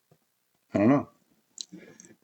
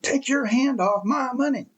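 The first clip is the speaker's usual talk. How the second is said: mocking imitation of a protester's scream; 11 semitones higher than usual. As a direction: imitate the protester squealing his demand. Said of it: "and screams it".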